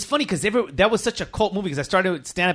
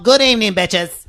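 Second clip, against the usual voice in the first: loud, higher Cat Williams greeting; a touch of Cat Williams, loud and high; Cat Williams impression; 4 semitones higher, 7 dB louder than usual. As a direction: announce it big like Cat Williams. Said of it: "fuck with Cat Williams".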